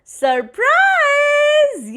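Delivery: high, loud playful call; spring the surprise on the child; cheerful yell. A narrator's reading. Mother announcing the surprise to her child, cheerful yell. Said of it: "yelled Mom".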